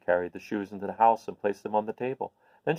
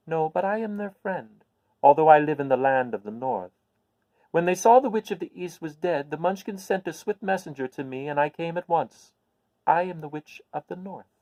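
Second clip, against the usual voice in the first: put-on higher, kindly old witch telling news; a little old witch, pleased to share good news; 6 semitones higher, 4 dB louder than usual. Witch introduces herself.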